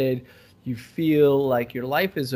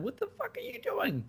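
incredulous; 11 dB quieter than usual. Real, not acted. Outraged at Club Med.

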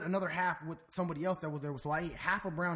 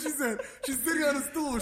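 ordinary talk

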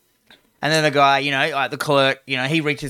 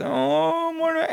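put-on scared kid; 14 semitones higher, 3 dB quieter, slower than usual. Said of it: "and he goes".

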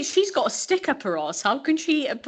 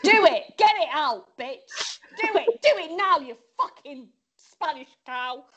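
mock furious midwife; 5 semitones higher than usual. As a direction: bark orders at the mother. Mock bullying midwife rant.

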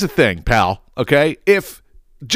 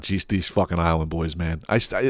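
ordinary delivery

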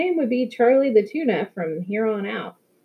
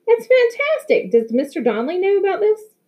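delighted surprise; 11 semitones higher than usual. A narrator's reading. Mom thrilled by Maya news.

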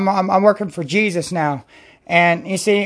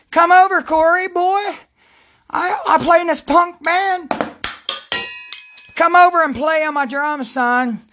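quoting a friend; 8 semitones higher than usual. Retold or imitated. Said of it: "He said".

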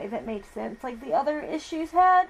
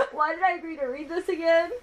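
laughing; 6 semitones higher, 4 dB louder than usual. Real, not acted. Laughing, playful complaint to co-host.

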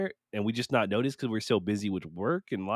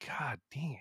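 much softer, muttered under the breath; muttered curse; 2 semitones higher, 11 dB quieter than usual. Retold or imitated. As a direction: grumble quietly to yourself. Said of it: "I'm like".

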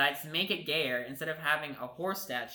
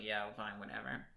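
quiet resigned shrug; 5 semitones lower, 10 dB quieter than usual. Retold or imitated. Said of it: "the bottom's like".

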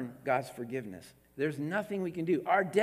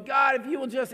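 pleading bargainer; 8 semitones higher, 5 dB louder than usual. Retold or imitated. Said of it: "this servant is going".

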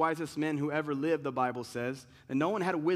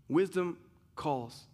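ordinary talk, nothing marked

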